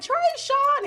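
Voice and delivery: high loud crying call; calling out name. Retold or imitated. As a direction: call the lost boy's name desperately. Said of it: "She's like".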